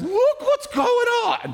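high, loud, drawn-out mock panic; comic slow-stretched squeal of alarm; freaking out. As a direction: play a hammed-up panicked cry, way up high. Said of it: "Freaking out".

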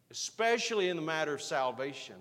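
ordinary delivery

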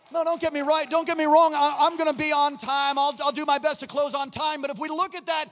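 loud, high preacher's cry; fiery preaching. Acted. Preacher rallying the church that nothing can stop them, full force. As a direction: build and hammer each line.

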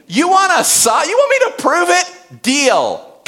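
the archangel snaps back, mock indignant; rude, direct retort. Gabriel firing back at doubting Zechariah, building to a shout. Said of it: "it is rude. It is direct".

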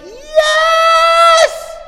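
long, high shouted yes; imitated cheering cry. Preacher imitating woman stomping her feet, long cheering shout. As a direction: shout it out like a worshipper overcome. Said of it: "and she was like".

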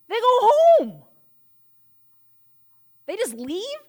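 can you believe it, they went home; shocked outburst to the congregation; incredulous. Real, not acted. Preacher exclaims disbelief at disciples.

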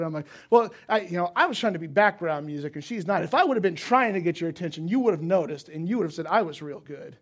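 loud, heated self-defence; musician's ego stung, loud defensive retort; defensive rant. Retold or imitated. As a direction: argue back, wounded pride. Blood pressure rising.

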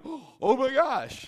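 hammed-up panic at the mailbox bill; mock panic. Acting out a worried person.

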